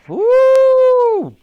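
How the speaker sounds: loud, very high, long "woo"; mammoth hoot. A narrator's reading. Wooley falls onto the Admiral, high hoot. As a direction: hoot while toppling over.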